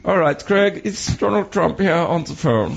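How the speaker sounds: put-on slow, careful German-accented impersonation. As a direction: play the caller with a thick comic German accent. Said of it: "the German thing".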